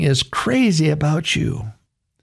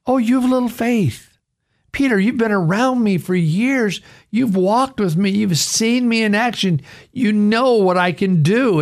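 chiding; 7 semitones higher than usual. Acted. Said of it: "And he said".

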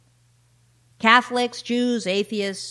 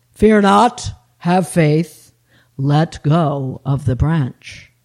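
loud, slow commands dropping low; voice of God; 4 semitones lower, 8 dB louder, much slower than usual. Acted. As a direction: boom it out solemnly as God. God answers the sheep.

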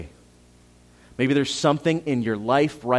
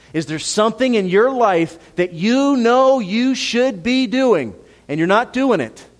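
higher, louder, slow pointed challenge; urgent preaching; 8 semitones higher, 5 dB louder than usual. Acted. Pastor challenging the congregation, urgent preaching. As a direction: press each listener in the pew.

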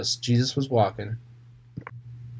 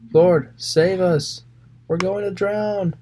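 high, slow, desperate cry for help; panicked plea; 8 semitones higher, 3 dB louder, slower than usual. Acted. Terrified disciples begging Jesus to save them, high and slow. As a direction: plead like a frightened sailor in a storm.

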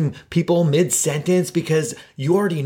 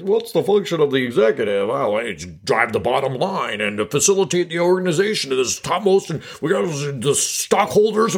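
mock stuffy CEO voice, rambling on; pompous corporate-speak parody; stuffy executive. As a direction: put on a droning boardroom bigwig. Parody of boring executive talk.

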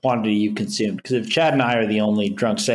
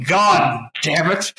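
drawn-out, higher mock cursing; mock exasperation; 5 semitones higher, much slower than usual. Recounting to co-hosts a surrender to temptation, mock exasperation. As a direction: draw out the curse in mock frustration.

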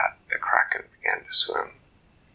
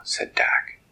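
plain reading voice

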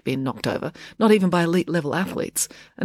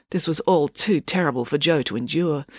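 plain reading voice

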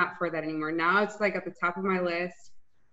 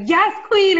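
high, loud hype cheer; hyped cheer; 11 semitones higher, 10 dB louder than usual. Retold or imitated. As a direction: cheer her on like a hype friend. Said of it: "being like".

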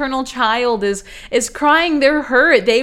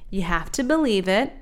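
ordinary talk

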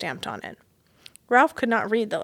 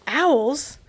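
sudden higher, louder alarm; startled question; 5 semitones higher, 5 dB louder than usual. Acted. Frightened mouse hearing owls, startled. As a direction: jump at the word.

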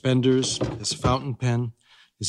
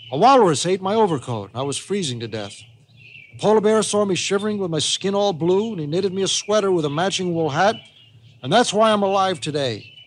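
high, animated grandpa storyteller; lilting, emphatic yarn from an old adventurer; grandpa telling tall tale; 7 semitones higher, 3 dB louder than usual. Acted. Grandpa telling Molly of his polar rescue, animated.

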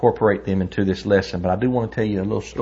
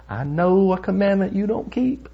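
gloating challenger; 11 semitones higher than usual. Retold or imitated. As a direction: imitate a confident doorstep challenger, pitched way up. Retold doorstep challenger confronting TJ.

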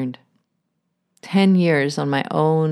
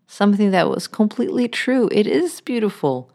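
ordinary talk

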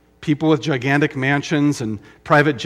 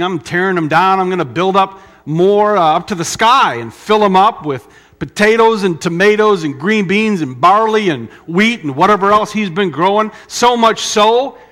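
boastful rich farmer; 6 semitones higher, 7 dB louder than usual. Retold or imitated. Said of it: "He has this bumper crop".

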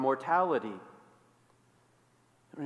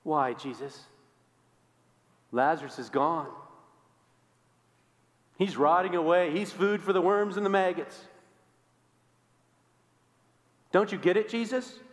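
building protest. Acted.

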